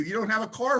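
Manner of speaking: gleeful imitation of the sponsor; excited sponsor. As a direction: sponsor thrilled by the bad news. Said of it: "he's getting excited".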